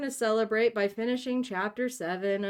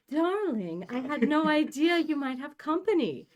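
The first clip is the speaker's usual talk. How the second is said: high-pitched Transatlantic society lady exclaiming; Transatlantic accent; 8 semitones higher, 4 dB louder than usual. Acted. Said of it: "trying to master this particular voice".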